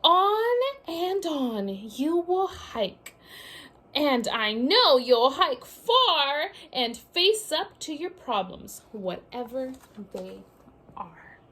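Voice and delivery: lively picture-book read-aloud; sing-song storybook reading; reading to children. A narrator's reading.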